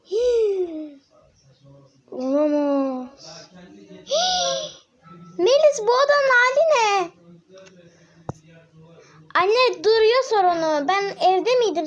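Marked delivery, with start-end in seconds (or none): 0.1-4.7 mother horrified at the mess; shocked. Acted. Mother sees the room. 5.4-7.1 loud, higher mother's scolding; a mother telling off her daughter. Acted. Mother scolds about the room.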